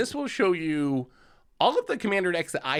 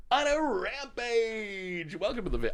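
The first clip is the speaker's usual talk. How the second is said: fighting game announcer; 7 semitones higher, 4 dB quieter than usual. Retold or imitated. Streamer mimics announcer callout.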